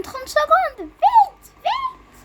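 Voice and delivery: high, rushed urging; urgent excited. Real, not acted. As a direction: hurry them, almost squeaking. Hurrying friend to plane.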